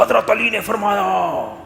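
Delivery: high, slow booming pulpit call; imitating loud imam. Imam preaching over a loud speaker, imitated booming. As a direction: boom it out like a blaring imam. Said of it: "میرے نا کان پھٹنے لگے".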